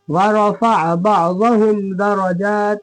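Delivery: slow, measured chant with held long vowels; Quran recitation. Shown as a demonstration. Teacher reciting the verse for students, slow measured chant. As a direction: recite slowly and evenly, drawing out long syllables.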